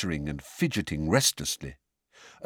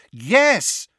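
high, loud, angry retort; 7 dB louder than usual. A narrator's reading. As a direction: snap back angrily, pitched up. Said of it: "said Harry angrily".

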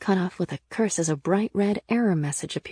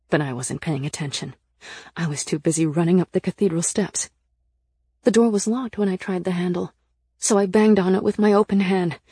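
plain reading voice

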